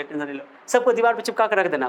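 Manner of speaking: normal talking voice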